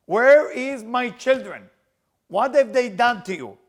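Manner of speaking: loud, slow wail of grief; slow, loud tearful outcry; crying protest. Acted. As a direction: Rachel crying out at God over her dying children. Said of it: "Rachel was screaming and crying".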